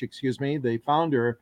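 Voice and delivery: normal talking voice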